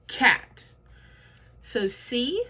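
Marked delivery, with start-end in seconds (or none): 0.1-0.6 Cat said as one word, onset and rime joined; teacher modelling a blended word. Shown as a demonstration. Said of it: "Now let's blend it together".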